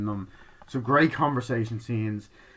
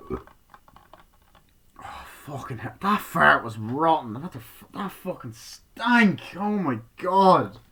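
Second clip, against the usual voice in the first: high, drawn-out revulsion; real disgust; 5 semitones higher, 4 dB louder, slower than usual. Real, not acted. Speaker reacting to his own fart, real disgust. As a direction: exclaim it loudly, appalled by the stink.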